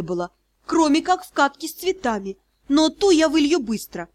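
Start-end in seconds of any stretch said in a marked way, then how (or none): none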